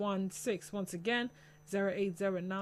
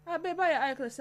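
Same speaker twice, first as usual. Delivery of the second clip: sharp higher question; suspicious girlfriend; 7 semitones higher, 4 dB louder, faster than usual. Acted. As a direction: catch him with one eye shut.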